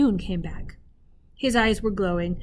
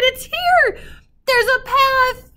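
much higher and louder joyful cry; wild shout; 9 dB louder, slower than usual. Acted. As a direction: shout it out with sudden wild relief. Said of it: "Lena gave a wild shout".

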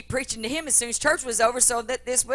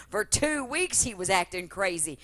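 ordinary talk, nothing marked

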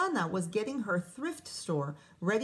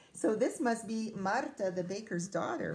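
ordinary delivery, nothing marked

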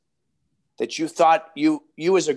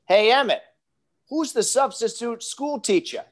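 put-on Boston accent; 8 semitones higher than usual. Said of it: "he's got his Boston accent".

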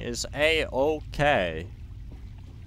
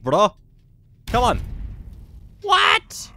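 frustrated shouting; 8 semitones higher, 7 dB louder than usual. Real, not acted. Grenades failing against enemy.

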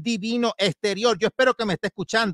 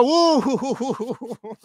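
breaking into laughter; giggling mid-sentence; laughing; 6 semitones higher, slower than usual. Real, not acted. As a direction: laugh through the words. Laughing at own remark.

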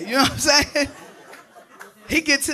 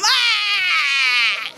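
disgusted gag cry; 3 semitones higher than usual. Retold or imitated. Spit in mouth, gagging.

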